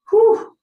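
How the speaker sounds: loud, high "whew"; high whoop. Real, not acted. Recovering from the funny border story, high loud whew. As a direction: let out a piercing, airy whoo. Recovering after the acted laughing fit.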